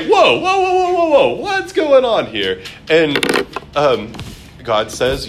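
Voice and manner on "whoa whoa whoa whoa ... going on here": high, loud mock panic, half laughing; a hammed-up frightened yelp; panicked whoa. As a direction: squeal it out like a terrified king caught out. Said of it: "Abimelech is like".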